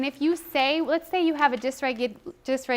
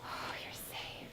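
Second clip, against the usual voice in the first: whispered reassurance; soft whispered comfort; soothing whisper; 18 dB quieter, slower than usual. Shown as a demonstration. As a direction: whisper it to calm her. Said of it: "So I have to read her now".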